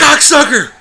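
sudden high, loud shout; hammed-up involuntary cursing shriek; mock Tourette's outburst. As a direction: blurt the swear as a high-pitched yell. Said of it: "I'm having a mild case of Tourette's".

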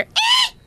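high squeal; squeaky roach scream. Retold or imitated. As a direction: shriek it, tiny and high. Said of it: "I hear".

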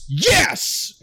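high, thrilled cheer; retelling his own joyful yell in the theater; excited yes. Retold or imitated. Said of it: "And I went".

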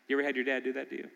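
ordinary talk, nothing marked

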